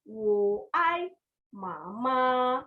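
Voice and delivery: ordinary talk, nothing marked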